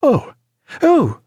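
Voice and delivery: high-pitched cry of dismay; horrified, pitched far above normal; aghast. A narrator's reading. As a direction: gasp it out high, appalled. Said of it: "cried Stephen, aghast".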